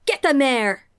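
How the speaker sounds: loud, put-on superhero villain-hunter voice; mock-gruff shouted order; Batman. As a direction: bellow it as a hammed-up caped crusader. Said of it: "It's Batman".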